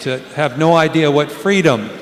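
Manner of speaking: ordinary talk, nothing marked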